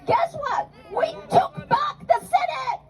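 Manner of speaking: high, triumphant rally cry; triumphant shout. Real, not acted.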